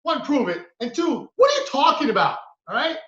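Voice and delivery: usual speaking voice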